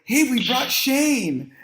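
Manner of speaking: high, drawn-out party hail; imagined excited announcement. Retold or imitated. Kid announcing his guest at the bonfire, excited high call. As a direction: announce the guest to a cheering crowd. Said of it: "I'm gonna be all excited".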